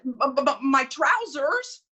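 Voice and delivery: stammering, taken aback; a man stunned into stuttering; shocked stammer. Acted. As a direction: sputter at the outrageous request.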